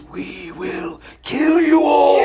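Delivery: high, slow, shouted minion screech; the virus's minions threatening everyone. Pretend. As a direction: screech it like a tiny evil minion. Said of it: "And the virus's minions".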